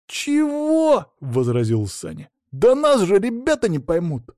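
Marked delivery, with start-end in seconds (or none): 0.1-1.1 high-pitched outraged "what?"; much higher, incredulous objection; indignant disbelief. Acted. Саня возмущённо возражает. 2.5-4.3 high-pitched objection; whiny, worked-up complaint to the mentor; indignant protest. Acted. Саня возражает наставнику.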